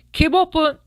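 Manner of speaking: high, loud yell. A narrator's reading. Dentist yelling at the fox's quivering jaw, loud. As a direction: yell the order. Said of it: "yelled Doctor Dussault".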